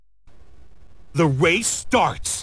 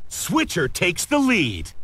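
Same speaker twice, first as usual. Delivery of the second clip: ordinary delivery, nothing marked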